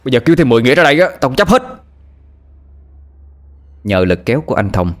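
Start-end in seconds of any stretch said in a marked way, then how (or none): 0.0-1.6 loud, defiant bark rising higher; angry shout. Acted. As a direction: snap back fearless and loud. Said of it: "Anh Thông vừa kéo vừa quát".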